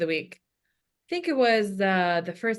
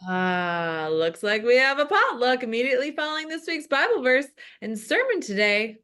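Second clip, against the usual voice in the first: excited reading; 4 semitones higher than usual. Acted. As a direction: rush through it, thrilled about food. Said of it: "with excitement".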